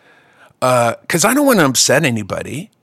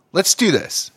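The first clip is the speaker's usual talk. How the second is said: ordinary delivery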